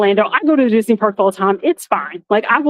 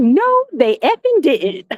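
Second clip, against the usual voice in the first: slow, high, sassy denial; sassy disbelief; 6 semitones higher, slower than usual. Retold or imitated. Kicked out of the system, slow sassy outrage. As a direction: spell out each word with attitude. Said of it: "And I was like".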